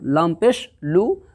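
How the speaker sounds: slow, drawn recitation of letters; spelling out syllables. Shown as a demonstration. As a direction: sound out each letter slowly like a Quran teacher. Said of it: "रसू".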